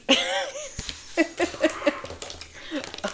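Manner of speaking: shrieking high burst of laughter; high cackle. Real, not acted. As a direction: crack up at your own joke. Laughing at own joke.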